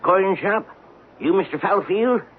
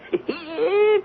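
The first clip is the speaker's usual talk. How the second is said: high giddy cackle of greed; gleeful cackle. Acted. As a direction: burst into a miser's gleeful laugh. Miser alone gloating over dime.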